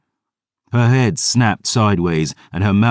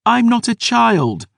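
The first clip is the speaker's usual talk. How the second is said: much higher, loud, slow protest; girl's high defiant cry; 4 dB louder than usual. Acted. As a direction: shout it back through hurt. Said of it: "Dior's cry cut me off".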